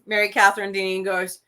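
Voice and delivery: ordinary talk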